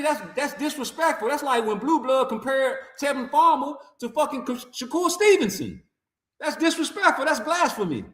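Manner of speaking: ordinary delivery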